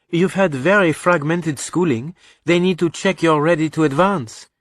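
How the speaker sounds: high-pitched voice for a mother; explaining mother. Acted. Mother telling son about school policy, explaining.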